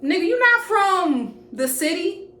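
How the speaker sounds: high, loud mocking jeer; a loud sneering imitation of the guy talking trash; mocking his friend. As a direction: throw his words back at him, jeering. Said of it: "he said jaw like".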